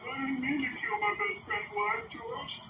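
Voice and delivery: slow, dim-witted Lennie voice; Lennie impression. Imitating Lennie pleading to George, slow and simple.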